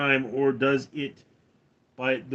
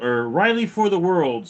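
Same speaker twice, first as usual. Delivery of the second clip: ordinary talk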